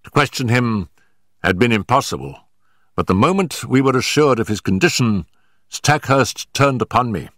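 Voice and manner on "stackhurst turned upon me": usual speaking voice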